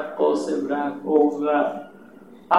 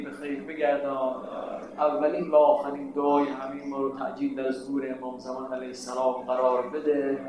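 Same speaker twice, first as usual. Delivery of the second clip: soft, low supplication; prayer; 3 semitones lower, 6 dB quieter than usual. Acted. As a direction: pray quietly over the congregation. (دعای آرام و آهسته)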